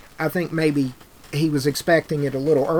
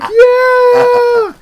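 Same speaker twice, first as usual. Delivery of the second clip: long, very high whooping shout; excited yell; 12 dB louder than usual. Retold or imitated. Telling his cohost how he reacted, with an ecstatic yell. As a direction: let out a huge falling whoop of agreement. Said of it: "And I'm like".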